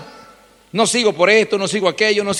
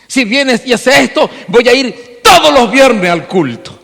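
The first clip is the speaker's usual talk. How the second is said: mock bargaining believer; 6 semitones higher, 6 dB louder, slower than usual. Voices bargaining believer again.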